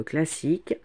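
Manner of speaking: ordinary delivery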